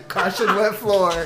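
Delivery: slow laughing announcement. Real, not acted. Laughing joke title.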